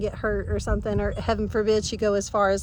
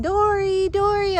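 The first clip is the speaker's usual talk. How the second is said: high, loud call across the yard; 9 semitones higher, 3 dB louder, slower than usual. Retold or imitated. Owner calling her lost dog in the yard. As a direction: call her name out into the woods.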